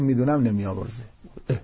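a hint of despairing, resigned tone; lightly voiced man who gives up on mercy; hopeless muttered aside. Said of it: "این چه لحنیه؟".

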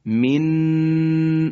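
teacher shows the faulty pause on the nun of من; wrong held nun. Shown as a demonstration. Teacher demonstrates to students a wrongly held nun.

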